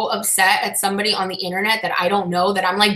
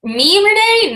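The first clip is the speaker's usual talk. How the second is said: high, loud, disbelieving "me?"; surprised squeal; 12 semitones higher, 6 dB louder, slower than usual. Real, not acted. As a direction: squeak it out, flattered and shocked. Surprised to be mentioned.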